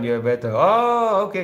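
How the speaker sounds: ordinary delivery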